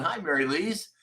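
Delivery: ordinary talk, nothing marked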